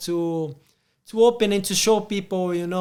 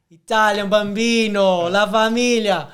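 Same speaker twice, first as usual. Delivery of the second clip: playful hammed-up Italian accent, louder; mock Italian; 3 semitones higher, 9 dB louder than usual. Host joking about Italian words, in mock Italian accent. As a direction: toss off the words like a cartoon Italian.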